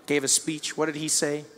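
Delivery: ordinary delivery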